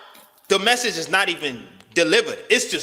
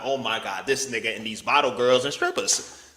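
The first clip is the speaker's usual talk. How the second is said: low, soft gasp of a shocked viewer; a hint of a judging fan; mock scandalized viewer; 7 semitones lower, 4 dB quieter than usual. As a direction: lower and softer, clutching pearls. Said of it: "gonna be like".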